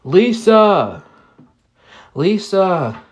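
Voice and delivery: a mild retold yell across the cold; calling out. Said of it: "we were both yelling out".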